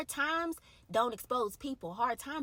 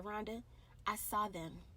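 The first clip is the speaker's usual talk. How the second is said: gentle whisper of God; 3 semitones lower, 8 dB quieter than usual. Retold or imitated. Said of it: "whispered something real, real gentle in my ear".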